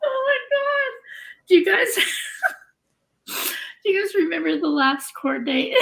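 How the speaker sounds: high, fast, teary laughter in the voice; real crying laughter; cry laughing. Real, not acted. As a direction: talk while the laugh takes over. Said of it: "I'm gonna start cry laughing again".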